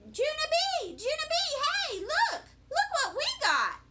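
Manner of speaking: put-on high-pitched kids calling out; hollering. A narrator's reading. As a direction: holler excitedly to a friend. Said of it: "I heard voices hollering".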